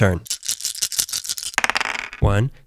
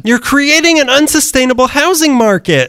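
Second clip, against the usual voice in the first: high indignant; 11 semitones higher than usual. Acted. Rising panic over rules.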